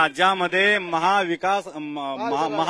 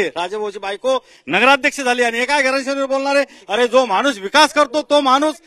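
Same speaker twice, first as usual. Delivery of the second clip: high, raised rally voice; heated rebuttal; 7 semitones higher, 3 dB louder than usual. Real, not acted. Party supporter rebutting dynasty charges to rivals, heated and high. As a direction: drive it home at a high pitch.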